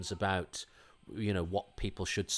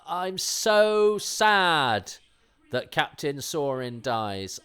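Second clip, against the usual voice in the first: high, loud and very slow drawn-out wail; mock lament; 6 semitones higher, 9 dB louder, much slower than usual. Real, not acted. Podcaster grieving Captain Sorin's death, hammed-up slow high wail. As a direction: ham up the sorrow over the character's death. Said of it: "I think that's really sad".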